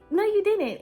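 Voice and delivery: high, loud flat denial; indignant. Retold or imitated. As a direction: shut him down loudly. Contradicting trainer.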